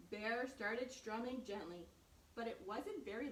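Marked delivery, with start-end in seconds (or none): none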